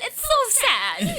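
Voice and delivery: mock sad wail. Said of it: "I was like".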